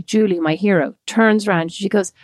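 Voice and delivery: usual speaking voice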